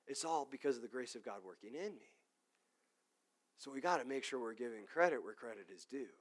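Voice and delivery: quiet sincere. Real, not acted. Quiet earnest sermon close.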